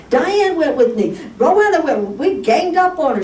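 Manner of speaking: high-pitched, worked-up lament; exasperated venting. Real, not acted. Pastor telling church nobody listened to the pain, exasperated. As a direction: pour out the frustration, pitch held high.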